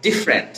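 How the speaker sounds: ডিফারেন্ট run together as dif-rent; two-syllable pronunciation. Shown as a demonstration. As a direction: say ডিফারেন্ট without its middle vowel. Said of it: "উচ্চারণটা হচ্ছে কি?".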